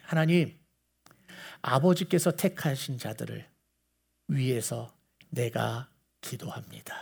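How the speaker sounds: low, soft acted prayer; hushed prayer. Jesus praying for the chosen, hushed.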